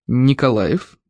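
low, weighty solemn voice; solemn, significant. A narrator's reading. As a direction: intone the surname with great importance. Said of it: "значительным, но и торжественным голосом".

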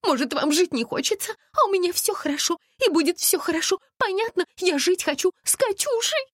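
high, tearful hysterical woman; bursting into tears. A narrator's reading. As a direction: wail defensively in a very high voice. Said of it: "расплакалась Настя".

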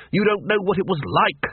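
muttering. Acted. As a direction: grumble an excuse without backing down. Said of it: "muttered the Dean".

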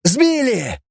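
high shouted cry. A narrator's reading. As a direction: call out the news. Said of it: "закричал".

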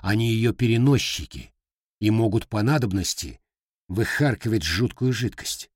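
ordinary delivery

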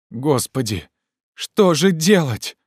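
high, slow exclamation of despair; despairing cry. A narrator's reading. Legrand at a loss over branch, in despair. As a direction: wail the question helplessly. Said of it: "с неподдельным отчаянием в голосе".

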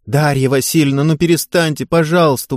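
usual speaking voice

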